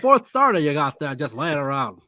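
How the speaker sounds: loud, hammed-up old-timey patter; put-on bygone-era baseball man; old-timer making conversation. Old GM asks after a spare starter, old-timer voice. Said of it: "the kind of age where you started a conversation by saying".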